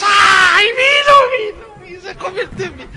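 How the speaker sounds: very high, loud, sobbing shrieks of joy; mock ecstatic crowd. Megachurch crowd seeing water turn to wine, hammed-up sobbing cry. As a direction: squeal like the overwhelmed faithful.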